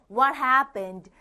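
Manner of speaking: very slow, clear English model; slow model line. Shown as a demonstration. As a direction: spell it out slowly for learners. Teacher models dialogue slowly. (또박또박 천천히)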